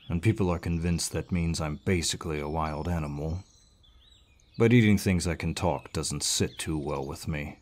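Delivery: ordinary talk, nothing marked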